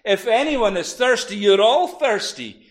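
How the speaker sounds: loud, high call to the crowd; crying out loud. Acted. Jesus crying out to the thirsty crowd. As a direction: cry it out with authority. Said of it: "crying out in a loud voice".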